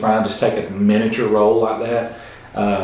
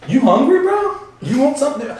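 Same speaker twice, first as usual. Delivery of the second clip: famous movie line. Retold or imitated. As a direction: belt it out like an overexcited host. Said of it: "he hits the world famous".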